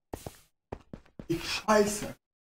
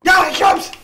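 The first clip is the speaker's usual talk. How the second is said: loud high cheer; excited cheer; 9 semitones higher, 9 dB louder than usual. Real, not acted. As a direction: cheer the win. Cheering after success.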